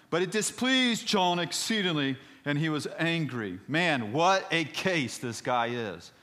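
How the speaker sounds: loud, high scripture reading; big, emphatic sermon voice; dramatic preaching. Real, not acted. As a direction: proclaim it forcefully from the pulpit.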